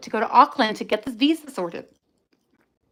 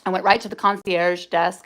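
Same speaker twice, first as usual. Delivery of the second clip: ordinary delivery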